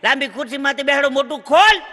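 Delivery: high, loud mock dentist's command; barked order. Dentist ordering the patient about. (ઊંચા અવાજે હુકમ)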